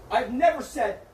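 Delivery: arguing, loudly denying it; loud denial. Real, not acted. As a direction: slam the denial. Heated denial in argument.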